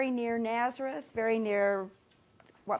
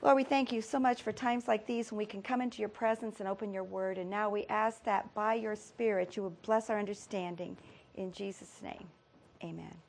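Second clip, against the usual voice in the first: soft, lower-toned reverent prayer; 3 semitones lower, 6 dB quieter than usual. Real, not acted. Teacher opens Bible class with prayer, quiet prayer. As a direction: pray quietly, voice settling toward the amen.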